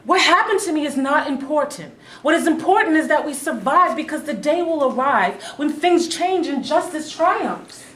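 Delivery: loud, high, passionate conviction; fervent insistence. Acted. Wife urging husband to endure, loud and fervent. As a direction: declare it like a creed.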